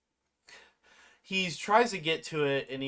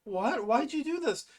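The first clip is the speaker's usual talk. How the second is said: mock-distraught, higher-pitched question; 9 semitones higher than usual. Ribbit asks why he did this, distraught. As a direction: act out a distraught friend's disbelief.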